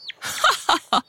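high, soft villain's laugh; acted gloating cackle; burst of laughter. A narrator's reading. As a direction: laugh like a fox who has won. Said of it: "Le renard éclate de rire".

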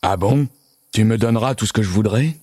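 monster calming a little, slightly put-on voice; a milder monster, striking a bargain; toned-down monster voice, questioning then demanding; softened monster. A narrator's reading. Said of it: "Le monstre se radoucit un peu".

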